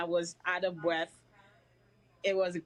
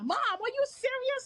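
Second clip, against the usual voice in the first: son's disbelief. Retold or imitated. Son's astonished reply retold.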